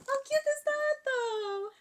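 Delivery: very high, loud and drawn out; real over-the-top cuteness gushing; gushing squeal. Real, not acted. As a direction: squeal at something adorably cute. Gushes over cute gift.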